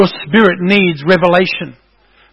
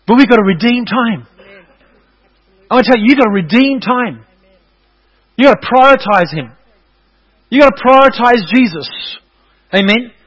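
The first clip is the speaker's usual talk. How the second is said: rising preaching; 5 semitones higher than usual. Acted.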